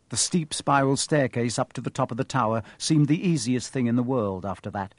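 ordinary delivery

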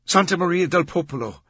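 high, loud command to a driver; ordering. A narrator's reading. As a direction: give the destination as an urgent order. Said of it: "she ordered".

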